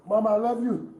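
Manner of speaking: loud, desperate cry of love through pain; anguished cry. Real, not acted. Pinned man cries out to his mother and child, anguished. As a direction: call out to loved ones as if it were goodbye.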